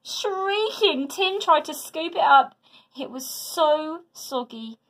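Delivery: ordinary delivery, nothing marked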